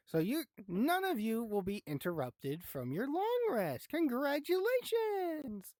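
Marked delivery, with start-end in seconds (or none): 3.9-5.7 mock congratulations. GM's ironic mock announcement.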